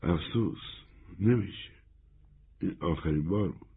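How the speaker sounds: soft, regretful refusal; hushed regret with short pauses; quietly. A narrator's reading. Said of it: "کنول آهسته گفت".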